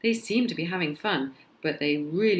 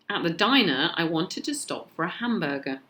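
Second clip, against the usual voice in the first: ordinary delivery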